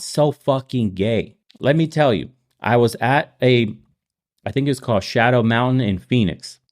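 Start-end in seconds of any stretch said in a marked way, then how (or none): none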